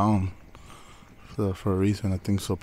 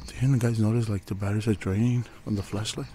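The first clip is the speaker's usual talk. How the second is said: usual speaking voice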